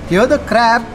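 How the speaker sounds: ordinary delivery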